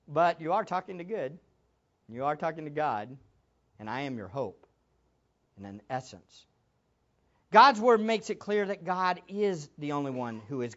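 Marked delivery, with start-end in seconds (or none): 0.1-6.5 much lower and softer, fading to near nothing; quietly offering hope; hushed, tender reveal. Acted. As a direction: drop to an intimate hush. Jesus voiced offering hope.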